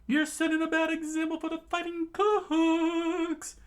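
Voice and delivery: very high, loud sing-song reproach; sing-song scolding. Acted. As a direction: chide in a high lilting voice. Scolding the old chef.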